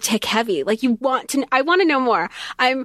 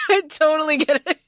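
laughing; 9 semitones higher than usual. Real, not acted. Laughs at own joke.

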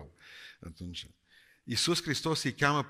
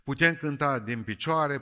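ordinary delivery

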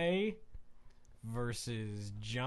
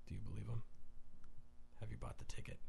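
quiet, low, eerie murmur; imitating a menacing fighter, hushed; soft, unsettling American voice; creepy hushed question; 6 semitones lower, 11 dB quieter, much faster than usual. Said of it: "without any accent, like, I'm talking a straight-up American accent".

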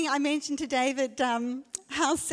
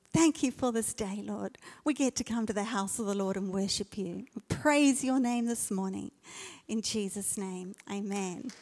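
prayer. Acted. Leads a prayer.

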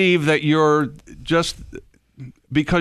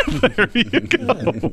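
laughing through the words; slow, laughing it out; 7 semitones higher, much slower than usual. Real, not acted. Laughing after a joke.

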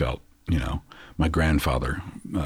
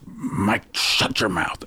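sharp, higher hushed rebuke; a grandfather hushing a whining boy; stern grandfather; 6 semitones higher, much slower than usual. Retold or imitated. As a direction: grandfather hushing the grandson so mom can rest. Grandfather scolds sick boy.

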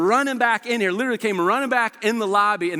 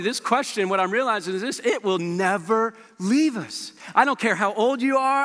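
ordinary delivery

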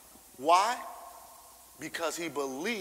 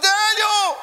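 "Daniel!" cried out much higher and much louder; anguished cry; 14 dB louder than usual. Shown as a demonstration. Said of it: "with a lament in his voice".

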